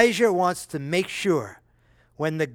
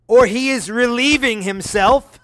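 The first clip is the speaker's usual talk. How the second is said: louder, higher, laughing through the jibe; laughing mockery; 6 semitones higher, 6 dB louder than usual. Elijah mocking the silent god, laughing jibe. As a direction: crack up at your own joke. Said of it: "Elijah's got a little sense of humor here".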